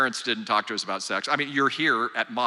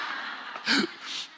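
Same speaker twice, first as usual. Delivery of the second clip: soft laugh; 6 dB quieter than usual. Real, not acted. Laughs at own joke.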